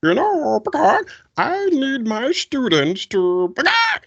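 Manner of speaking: high, slow, loud professorial chicken. Acted. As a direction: sound like a clucking but very smart professor. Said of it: "Professor Chicken".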